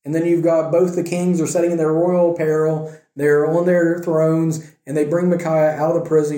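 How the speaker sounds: ordinary talk, nothing marked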